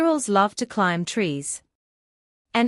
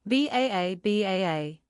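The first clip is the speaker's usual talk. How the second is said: bleating baa, fading softer; sheep bleat; 3 dB quieter than usual. Pretend.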